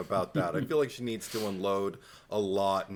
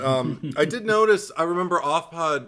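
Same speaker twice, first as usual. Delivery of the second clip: ordinary talk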